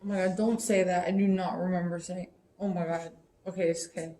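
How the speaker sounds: normal talking voice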